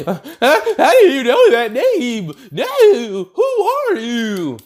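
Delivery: All Might panicking at a forbidden name; scared All Might. Retold or imitated. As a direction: stammer out in fear.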